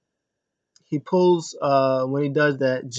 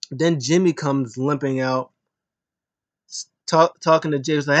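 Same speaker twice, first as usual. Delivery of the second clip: ordinary talk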